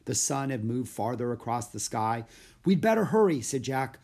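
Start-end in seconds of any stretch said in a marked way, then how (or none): 2.6-3.4 put-on high, loud urgent boy. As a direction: hurry him along. Jack urges hurry.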